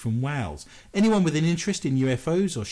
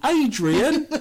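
high, loud cry of Adrian; loud, high mock shout of a mother; mock mother calling; 12 semitones higher, 6 dB louder than usual. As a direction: call his name like a scolding mum.

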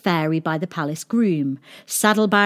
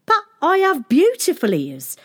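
put-on offended boy; 10 semitones higher, 4 dB louder than usual. A narrator's reading.

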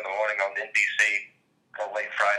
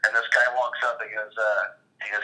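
normal talking voice

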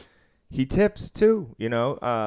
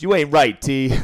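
louder and higher, a playful jab at the dealer; mock loud retort; 5 semitones higher, 9 dB louder than usual. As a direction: call it out across the table like a teasing gambler. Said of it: "And he goes".

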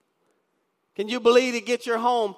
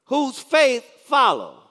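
slow reading; 4 semitones higher, 4 dB louder, slower than usual. Shown as a demonstration. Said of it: "read that real slow".

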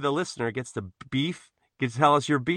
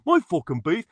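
mock cockney accent; 3 semitones higher, 4 dB louder than usual. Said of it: "his accent was fantastic".

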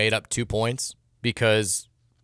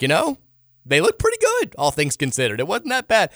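mock upbeat excuse; 7 semitones higher than usual. Mocking fans' upbeat excuses.

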